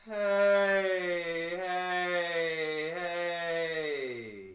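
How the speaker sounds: loud, sustained chest-and-head resonance; resonant call. Shown as a demonstration. Voice coach blends three places of resonance, full resonant call. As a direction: let each hey ring out long and fall away. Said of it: "Let's blend those three places of resonance".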